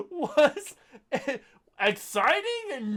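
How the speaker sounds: high, laughing mock-read of the line; quoting through laughter. Podcaster re-quoting the review, laughing. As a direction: parody the reviewer while giggling. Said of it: "it's like he's monologuing".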